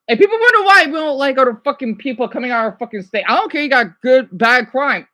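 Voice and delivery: worked-up, fast-starting rant; loud, exasperated griping; heated. Real, not acted. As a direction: vent with real irritation, pushing the volume. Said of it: "I'm getting a little bit heated".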